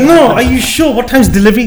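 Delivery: a laughing, panicky "are you sure?"; laughing alarm. Retold or imitated. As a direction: press him half-laughing, half-panicked. Pressing the shopkeeper, laughing.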